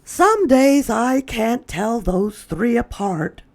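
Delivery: high put-on mother voice; Lily's mother's voice. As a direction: raise the voice for the mother. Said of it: "said Lily's mother".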